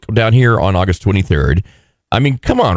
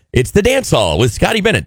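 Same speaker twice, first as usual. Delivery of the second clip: lightly imitated radio voiceover, pitched up; Jennifer Allen; 8 semitones higher than usual. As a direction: read it like a station promo, higher than usual. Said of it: "That's her voice. And she's got a really good radio voice".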